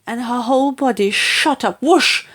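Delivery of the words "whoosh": vivid whoosh. A narrator's reading. Narrator voicing the sound effect.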